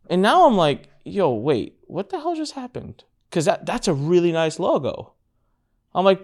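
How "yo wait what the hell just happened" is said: high, pulled back disbelief; as if the floor just dropped; mock stunned disbelief. Stunned reaction retold.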